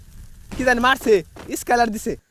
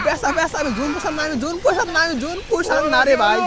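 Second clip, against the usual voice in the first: frustrated shouting; 7 semitones higher, 5 dB louder, faster than usual. Real, not acted. Frustrated outcry in fight.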